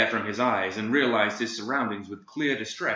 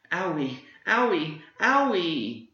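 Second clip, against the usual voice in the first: put-on high, slow, whimpering little boy; sobbing child; 8 semitones higher, slower than usual. Crying boy nursing his skinned knee, childlike sobbing whine. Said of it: "sobbing in the middle of the street".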